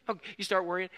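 loud, high-pitched preaching push; forceful preaching. Acted. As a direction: preach it out to the room with full force. Preaching at full force again.

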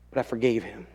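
ordinary talk

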